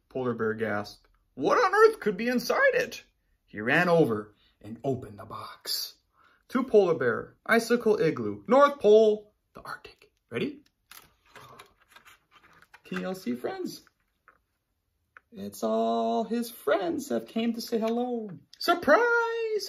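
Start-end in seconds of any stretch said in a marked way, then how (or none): 1.3-3.0 much higher, louder amazed bear. Acted. Polar Bear amazed at the big package, much higher and louder. 18.6-19.7 high, loud cheerful shout; shouted surprise. Acted. The friends shouting to Polar Bear, high and loud. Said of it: "his friends shouted".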